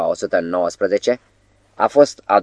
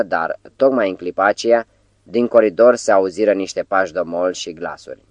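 ordinary delivery, nothing marked

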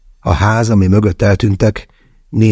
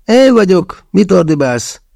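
Andrej's higher, louder indignant voice; a much higher, offended protest; 12 semitones higher, 3 dB louder, slower than usual. A narrator's reading. Andrej protests being yelled at, higher and indignant. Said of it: "méltatlankodott".

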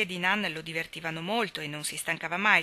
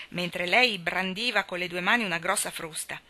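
usual speaking voice

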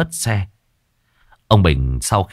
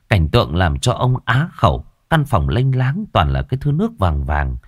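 ordinary delivery

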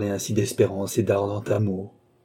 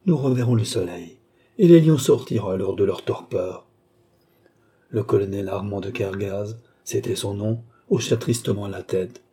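ordinary delivery, nothing marked

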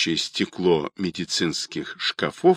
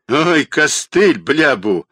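higher, exasperated outburst; angry; 6 semitones higher, 5 dB louder than usual. A narrator's reading. Caller tired of Rodionov's moralising, angry. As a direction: stretch the opening groan, then snap. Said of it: "разозлился голос".

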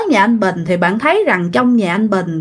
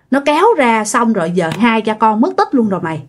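ordinary delivery

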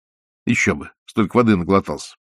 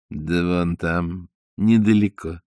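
low dolphin voice; 5 semitones lower, 3 dB quieter, slower than usual. A narrator's reading.

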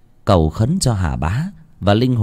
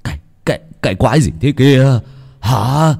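startled; 4 semitones higher than usual. Acted. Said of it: "giật nảy mình".

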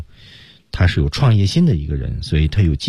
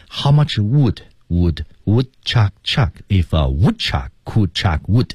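slow, each wood fully sounded; how much wood read slowly with full vowels; wrong, every word full; 3 semitones higher, slower than usual. Shown as a demonstration. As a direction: plod through every word. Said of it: "这样的句子是不能这么读的".

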